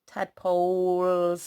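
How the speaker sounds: Tadpoles drawn out slowly, every sound clear. Shown as a demonstration. Teacher saying tadpoles slowly for spelling. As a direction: stretch out Tadpoles. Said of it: "sneaky little e".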